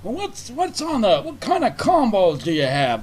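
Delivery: high, loud, drawn-out customer impression; mocked dithering customer. Imitating the guy ahead in line at Carl's Junior, dithering mockingly. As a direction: mimic a customer slowly puzzling over the order. Mimicking slow customer at counter.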